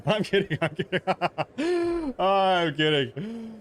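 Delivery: laughing through the words, drawn out. Real, not acted. Streamer laughing at his own gamer joke, laughing. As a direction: giggle while taking back the joke.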